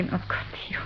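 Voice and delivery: faint whisper, no voice; exhausted new mother whispering back. Acted. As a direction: breathe it out weakly, barely voiced. Weak mother echoes greeting.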